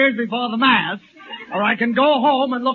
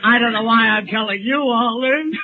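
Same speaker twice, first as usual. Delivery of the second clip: tearful breakdown; 5 semitones higher than usual. Acted. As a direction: break down crying for laughs. Comic breakdown over his marriage.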